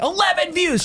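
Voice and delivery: very high-pitched mock yell; retold outburst. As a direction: yell it again in comic exasperation. Repeats his outburst to wife.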